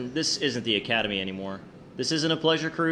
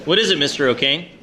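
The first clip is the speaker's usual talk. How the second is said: urgent call; 2 semitones higher, 8 dB louder than usual. Acted. Captain arrives on bridge in battle.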